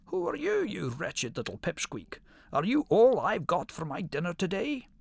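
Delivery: high-pitched roaring shout of a fierce lion; furious lion roaring at a pipsqueak. A narrator's reading. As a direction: bellow at the little hare in contempt. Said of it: "he roared".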